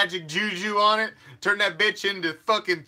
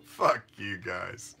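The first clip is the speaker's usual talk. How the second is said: laughing insult; 8 semitones lower, 6 dB quieter, much slower than usual. Real, not acted.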